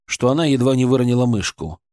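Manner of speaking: ordinary delivery, nothing marked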